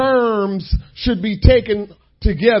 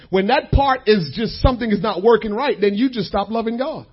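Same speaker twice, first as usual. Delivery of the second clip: ordinary talk, nothing marked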